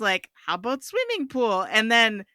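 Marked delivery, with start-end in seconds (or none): 0.5-1.6 put-on Hungarian accent. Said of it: "that's a really disrespectful accent to have".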